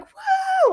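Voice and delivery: a high, loud whoop of joy; cheering woo. Retold or imitated. Cheering at the TV as the leader gets ousted, high whoop. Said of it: "Like, I started cheering".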